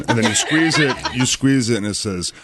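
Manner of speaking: normal talking voice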